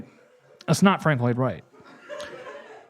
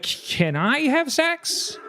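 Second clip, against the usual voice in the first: slow, high-pitched question; tentative request; 10 semitones higher, much slower than usual. Acted. Newcomer asking the brothel worker for sex, tentative. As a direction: draw it out high, as a hesitant newcomer.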